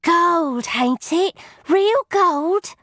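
put-on very high, eager boy's voice; excited cockney boy. A narrator's reading. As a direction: marvel at it like a wide-eyed lad. Joddy voiced in story.

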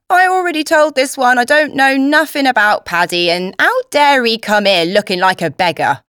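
loud, indignant Cockney grumbling; complaining cook. A narrator's reading. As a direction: complain at full voice like an affronted cook. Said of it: "The cook complained".

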